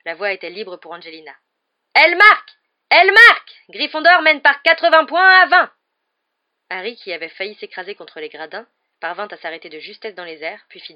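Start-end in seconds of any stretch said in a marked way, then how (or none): 1.9-5.7 loud, much higher celebration, slowing at the score; ecstatic goal call. Acted. Commentator celebrating the goal to the crowd, loud and high. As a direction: shout the goal with joy.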